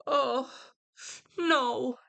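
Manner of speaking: high, hammed-up wail of dismay; mock heartbroken Juliet. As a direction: play Juliet's overdone despair. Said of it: "Then Julia hears this news and like".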